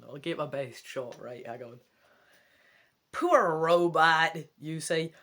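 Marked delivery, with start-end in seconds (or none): 3.1-4.4 slow, louder American accent on Poor robot; attempted American accent. Shown as a demonstration. Scottish reader voicing the kid to the robot, attempted American accent. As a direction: give it your best American accent. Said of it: "I'll give him an American accent".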